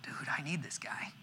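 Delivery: soft breathy aside, almost voiceless; hushed, like a private realisation; awed whisper. Retold or imitated. Awed by his mentor, he whispers to himself.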